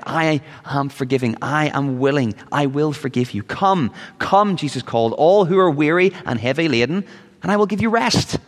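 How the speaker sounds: high, ringing invitation, climbing on the last line; fervent preacher voicing Jesus. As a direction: call the weary in with rising fervour. Voices Jesus inviting sinners.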